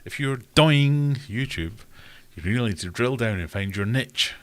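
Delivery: hammed-up YouTuber, DOY-ING and NITCH; loud mock YouTuber. Mocking a YouTuber's advice with loud DOY-ING.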